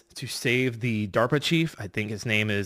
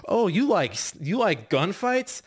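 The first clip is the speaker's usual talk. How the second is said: much higher, slow, sing-song taunt; taunting villain; 8 semitones higher than usual. Retold or imitated. Ocelot taunting Snake before the boss fight, high slow sneer. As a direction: tease him like a gleeful gunslinger.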